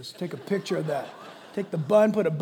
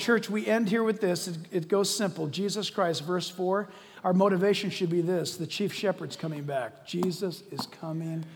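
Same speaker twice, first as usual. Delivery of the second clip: usual speaking voice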